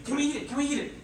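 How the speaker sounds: high, eager pleading dog; excited dog voice. Acted. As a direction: beg like an excited puppy.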